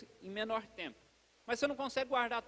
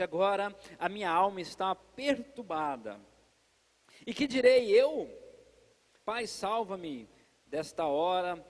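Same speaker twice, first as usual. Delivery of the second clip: solemn scripture reading; 4 semitones lower, slower than usual. Acted. Reads Jesus' words from John.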